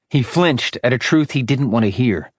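usual speaking voice

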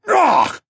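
loud high cry of pain; pained wail. A narrator's reading. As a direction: wail as the hook bites in. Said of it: "Siskorn wailed".

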